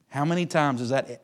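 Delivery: ordinary delivery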